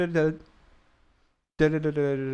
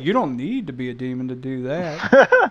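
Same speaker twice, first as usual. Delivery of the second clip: put-on Southern accent; 3 semitones higher, 5 dB louder than usual. Host imitating co-host in a mock Southern accent. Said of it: "Greg's like".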